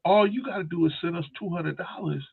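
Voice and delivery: high, salesy mock voice of a phone scammer. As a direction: oversell it up high like a con artist. Scammer pitch.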